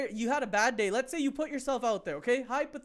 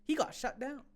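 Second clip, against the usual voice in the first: normal talking voice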